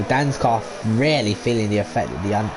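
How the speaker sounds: ordinary talk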